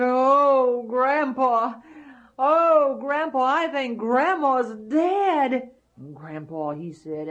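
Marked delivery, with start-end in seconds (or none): 0.0-5.7 put-on high, loud young boy's cry; excited boy. Jack tells Grandpa the giant is dead, high and loud.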